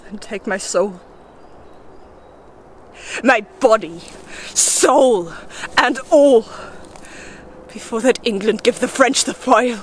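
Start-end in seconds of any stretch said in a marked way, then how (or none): 0.0-6.5 building word by word to a cry; desperate offering. Acted. Bargaining with spirits for victory, desperate building plea. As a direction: separate each offering with a pause, swelling to the last.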